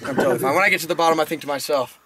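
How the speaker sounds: normal talking voice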